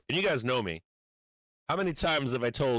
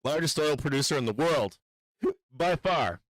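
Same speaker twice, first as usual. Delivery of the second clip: raised, put-on Trump impression; hammed-up boastful president, pitched up; mock Trump voice; 6 semitones higher than usual. Streamer mocks Trump bragging about oil, hammed-up impression. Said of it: "He's like".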